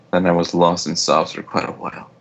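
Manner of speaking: ordinary delivery, nothing marked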